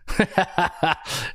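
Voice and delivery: amused laugh at a cheeky proposal; hearty laugh. Real, not acted. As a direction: burst of staccato laughter.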